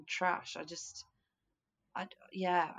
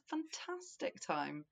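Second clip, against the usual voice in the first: normal talking voice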